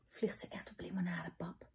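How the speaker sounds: near-whispered, very soft wondering; hushed question. A narrator's reading. Gijs alone beside papa at the plane, hushed question. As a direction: ask it under your breath.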